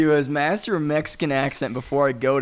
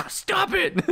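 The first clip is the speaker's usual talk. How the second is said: mock scolding wife. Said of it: "My wife elbows me".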